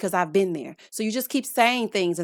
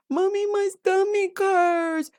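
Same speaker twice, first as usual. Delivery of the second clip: put-on higher, slow whining kid; whiny child voice; 9 semitones higher, slower than usual. Host imitating her son at bedtime, high and whiny. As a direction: whine like a kid dodging bedtime.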